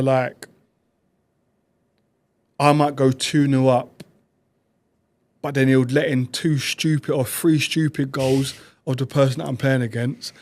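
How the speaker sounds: normal talking voice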